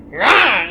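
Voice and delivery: loud, high imitated caw; sharp mock squawk; bird squawk. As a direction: screech like an angry bird. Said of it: "He squaws".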